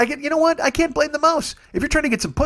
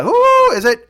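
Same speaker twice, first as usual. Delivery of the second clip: high, loud squawk of a parrot; 8 dB louder, slower than usual. Retold or imitated. The parrot taunts the plumber at the door, high squawk. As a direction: screech it like a cartoon parrot. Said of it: "a parrot that's taunting you".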